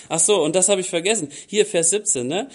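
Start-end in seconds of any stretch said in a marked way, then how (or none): none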